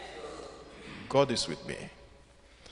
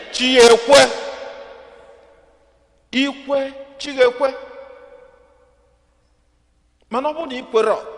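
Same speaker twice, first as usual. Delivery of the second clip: raised, driving sermon voice; preaching; 5 semitones higher, faster than usual. Real, not acted. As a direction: preach it to the whole church. Preaching at full voice.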